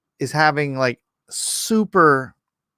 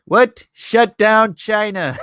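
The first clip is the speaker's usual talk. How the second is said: imitating Trump; 6 semitones higher, 5 dB louder, slower than usual. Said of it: "He's like".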